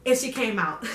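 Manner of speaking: ordinary talk, nothing marked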